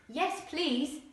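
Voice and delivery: put-on high, polite young boy; small, polite voice; a boy politely asking for bacon. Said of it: "Tad said".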